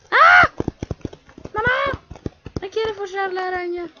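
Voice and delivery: high, loud scream for mom; terrified shriek. Real, not acted. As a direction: scream in panic. (grito agudo de pánico llamando a mamá)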